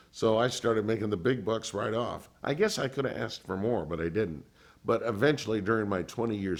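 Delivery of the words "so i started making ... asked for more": ordinary delivery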